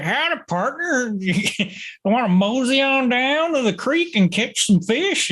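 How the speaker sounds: put-on high, playful old-time cowboy voice; old-timey cowboy voice. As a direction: ham up a folksy cowboy, laughing through it. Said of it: "old-timey cowboy voices".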